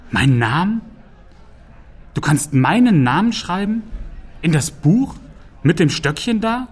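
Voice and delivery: high, rising incredulous questions; astonished. A narrator's reading. Troll astonished his name can be written.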